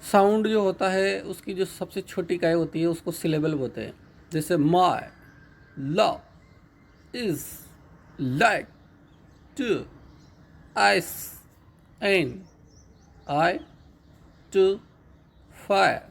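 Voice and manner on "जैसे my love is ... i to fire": "my love is like to ice and I to fire" split into single syllables with pauses; slow syllable-by-syllable reading. Shown as a demonstration. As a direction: count out each syllable with a pause between. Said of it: "इसमें दस syllable है".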